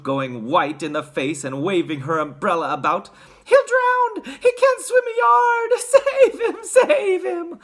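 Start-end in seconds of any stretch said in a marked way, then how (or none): 3.4-7.6 hysterical, very high shrieking; piercing mother's screams; Missus Gloop. A narrator's reading. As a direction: wail loudly in frantic panic. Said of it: "screamed Missus Gloop".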